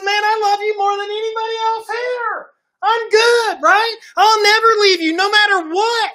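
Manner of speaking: high, loud, swaggering promise; cocky boast. Acted. Peter boasting to Jesus that he'll never leave. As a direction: brag like you can't fail.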